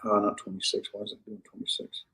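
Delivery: very soft, under-the-breath aside; talking to oneself. Real, not acted. Teacher puzzled by the verse number, muttering to self. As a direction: mutter it privately, barely projected.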